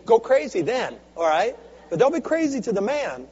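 ordinary talk

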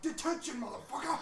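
high yelled kill taunt; mock battle cry. Principal yelling at students he shoots in game, high mock battle cry. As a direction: bellow it like a kill streak. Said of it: "he's like".

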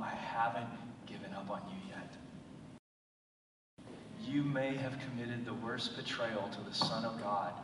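soft, low, gentle voice; God telling Peter he is still a disciple, soft and tender; tender reassurance. Acted. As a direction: comfort him quietly. God's words to Peter.

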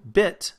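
"bit" said firmly with a clear short i; strong stressed vowel. Shown as a demonstration. Teacher showing a stressed vowel, "bit" said strongly. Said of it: "it's very nice and strong".

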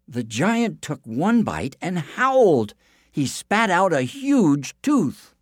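slow down for the painful moment; dramatic slow narration. A narrator's reading.

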